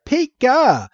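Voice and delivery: high squeaky Pika; lightly imitated Pikachu cheer; Pikachu cry. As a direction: chirp it out like Pikachu.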